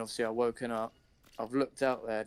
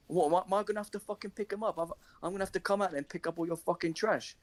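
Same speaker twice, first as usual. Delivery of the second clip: angry telling off; 7 semitones higher than usual. Retold or imitated. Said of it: "I'm like".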